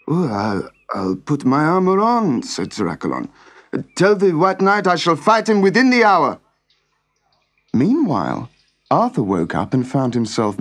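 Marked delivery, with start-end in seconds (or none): 0.1-6.4 sleepy knight agreeing. Acted. Accolon agrees to fight.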